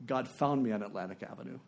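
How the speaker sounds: soft, low reading of a letter; hushed reading. Retold or imitated. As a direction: read it quietly and reverently. First reading of the letter's key line.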